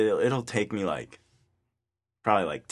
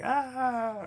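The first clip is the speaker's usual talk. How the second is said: mock laughter; 4 dB quieter than usual. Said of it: "rolling on the ground like".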